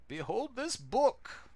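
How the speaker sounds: a solemn village elder warning of doom; wise old master. Acted. Master speaks again.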